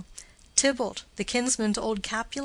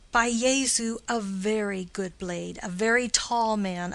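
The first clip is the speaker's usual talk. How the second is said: ordinary talk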